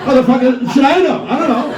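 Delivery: baffled high-pitched protest; exasperated outburst. Real, not acted. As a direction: throw up your hands in disbelief.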